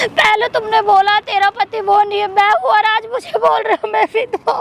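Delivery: crying in hurt disbelief; wounded, weeping accusation; tearful protest. Real, not acted. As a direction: protest through sobs. Told she is a widow, weeps.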